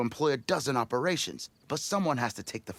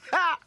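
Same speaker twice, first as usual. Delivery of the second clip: gloating laugh; 6 dB louder than usual. Acted. Gloating at rivals' death.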